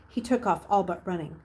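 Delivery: ordinary delivery, nothing marked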